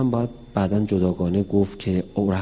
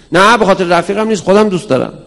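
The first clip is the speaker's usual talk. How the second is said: put-on child denying; 9 semitones higher, 11 dB louder, faster than usual. Child denies to father.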